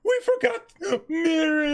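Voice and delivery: a slow, high, dramatic cry; high slow wail. Acted. Reenacted scene.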